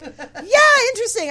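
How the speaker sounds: very high, loud opening easing off; awkward mock enthusiasm. Host imitating her reply to admirer, awkward high enthusiasm. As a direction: squeal a retold awkward yeah.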